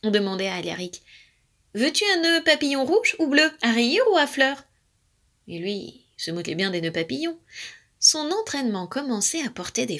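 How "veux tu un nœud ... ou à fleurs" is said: high, lively questioning; eager fussing. A narrator's reading. Family asking Alaric about bow ties, eager. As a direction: offer each choice eagerly.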